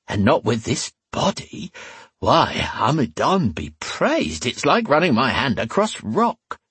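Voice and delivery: slow, high, gushing admiration; drawn-out coquettish lilt; flirty tavern woman. A narrator's reading. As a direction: gush over him as the forward barmaid.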